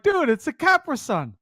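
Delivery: high mock insistence; stubbornly high Capra Sun; teasing friend voice; imitating Flint.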